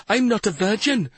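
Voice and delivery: high, pleading whine; whining. A narrator's reading. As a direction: whine it desperately. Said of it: "Carandin whined".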